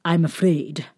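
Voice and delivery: ordinary delivery, nothing marked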